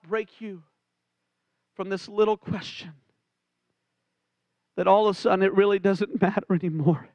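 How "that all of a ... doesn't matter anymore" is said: normal talking voice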